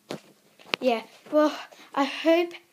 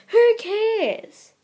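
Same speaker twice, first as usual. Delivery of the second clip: sudden high, loud who-cares cry; indignant outburst; 7 semitones higher, 5 dB louder than usual. Real, not acted. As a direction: fling it out in disgust. Upset kid ranting about dogs.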